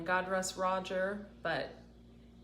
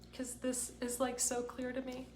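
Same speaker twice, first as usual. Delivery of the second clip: choked-up, higher and softer; on the verge of tears; 6 semitones higher, 4 dB quieter than usual. Real, not acted. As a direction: hold back real tears as you speak. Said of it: "I'm about to start crying".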